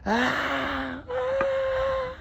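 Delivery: two very high, loud shrieks; repeated screams. A narrator's reading. He hears screams every now and then, piercing shrieks. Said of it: "he could hear screams".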